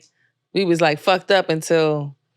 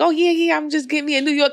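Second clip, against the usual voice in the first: naive suitor; 9 semitones higher, faster than usual. Retold or imitated. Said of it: "think like".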